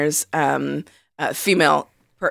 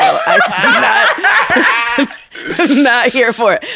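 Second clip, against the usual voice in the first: laughing mock rejection, louder; 7 semitones higher, 5 dB louder, much slower than usual. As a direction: laugh through the quote. Mock quote while laughing.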